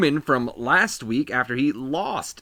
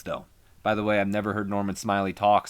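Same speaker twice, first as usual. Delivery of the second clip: ordinary talk, nothing marked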